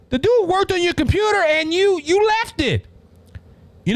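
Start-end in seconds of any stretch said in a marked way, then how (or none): 0.1-2.9 incredulous retort. Real, not acted. Indignant retort to Biden's claim.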